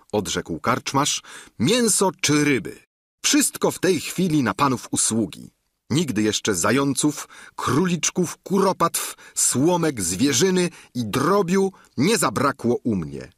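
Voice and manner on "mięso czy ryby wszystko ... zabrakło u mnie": lightly voiced eager innkeeper, a bit higher; a keen host boasting of his larder; hint of a bragging innkeeper.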